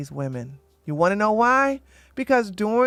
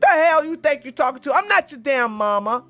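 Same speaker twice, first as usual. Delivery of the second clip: louder and higher, telling him off; 6 semitones higher, 4 dB louder than usual. Retold or imitated. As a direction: snap back loud, offended and fierce.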